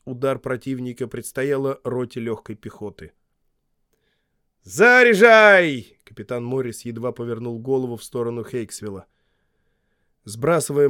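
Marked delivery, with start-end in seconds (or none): 4.6-6.0 high, slow, loud command; shouting drill sergeant. A narrator's reading. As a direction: drag the order out. Morris gives command. (протяжная команда)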